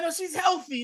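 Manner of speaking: high pleading disbelief. Retold or imitated. Son arguing with the nurse, high panicked disbelief. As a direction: insist it can't be true. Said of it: "He's like".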